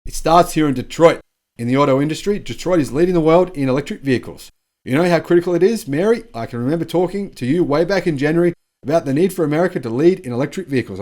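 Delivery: Joe Biden. Retold or imitated. Host quoting the president's praise of GM's boss, mock-oratorical. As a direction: lightly imitate a rousing political address. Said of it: "amplified by U S President Joe Biden".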